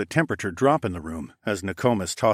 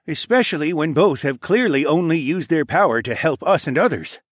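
higher, louder voice of authority; approving king; 8 semitones higher, 6 dB louder than usual. Acted. King agrees with queen about visitors, firmly approving. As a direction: back your queen's ruling firmly.

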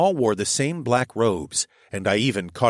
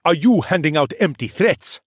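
sneered; 8 semitones higher, 5 dB louder than usual. A narrator's reading.

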